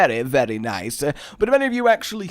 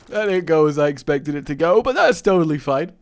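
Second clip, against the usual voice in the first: ordinary talk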